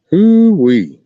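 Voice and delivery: high, loud hoo-wee of disbelief; a big incredulous whoop; whooping exclamation. Real, not acted. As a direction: holler it out in amazement. Reacting to library news.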